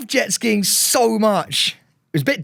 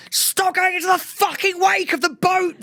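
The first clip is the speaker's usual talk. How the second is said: mock angry Pete; 12 semitones higher than usual. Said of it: "He's going".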